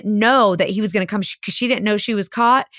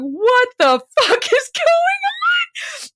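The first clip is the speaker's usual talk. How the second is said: very high, slow screamed disbelief; retold shrieking outrage; much slower than usual. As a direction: scream it like the stunned wife. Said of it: "the wife is going".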